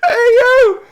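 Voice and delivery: bursting out laughing, voice cracking up high; real laughter breaking into a squeal. Real, not acted. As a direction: laugh helplessly through the words. Cracks up at the callout.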